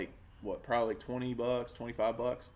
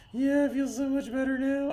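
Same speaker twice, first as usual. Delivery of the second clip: put-on much higher girly voice, relieved; mock relieved girlfriend; 12 semitones higher than usual. Mocking his ex after she puked, higher voice. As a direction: mimic her bright relief after puking. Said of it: "she's like".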